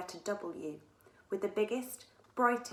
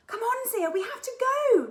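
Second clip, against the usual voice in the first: very high, loud desperate cry; urgent cry; 11 dB louder than usual. A narrator's reading. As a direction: shout to hurry her up. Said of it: "cried Katie".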